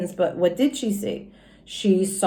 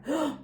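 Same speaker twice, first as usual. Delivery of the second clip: sharp breathless gasp of wonder; gasp of delight; 5 semitones higher, 9 dB quieter than usual. Acted. Junie B. spots the pen on the floor, delighted gasp. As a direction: gasp like you spotted treasure.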